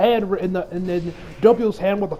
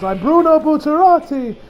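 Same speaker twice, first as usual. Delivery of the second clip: lightly voiced, raised and earnest hero; a hint of a proud gangster introducing himself; Bucciarati introducing himself; 8 semitones higher, 3 dB louder than usual. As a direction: announce yourself boldly, a bit higher.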